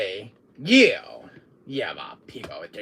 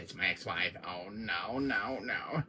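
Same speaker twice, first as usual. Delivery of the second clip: ordinary talk, nothing marked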